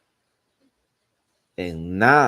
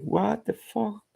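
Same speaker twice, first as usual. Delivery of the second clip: much higher, squeaky startled golem; 13 semitones higher than usual. Retold or imitated. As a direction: yelp it like a shocked monster. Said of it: "one of the golem is like".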